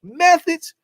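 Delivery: high, loud shouted movie line; Keenan's shout. Retold or imitated. Keenan yelling at the party, high loud shout. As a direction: holler it like the movie character. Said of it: "He said".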